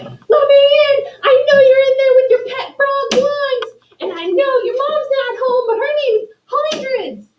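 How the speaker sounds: very high, loud, near-crying yell; furious mayor. Acted. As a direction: plead and rage at once, voice cracking high. Mayor yelling at door third time.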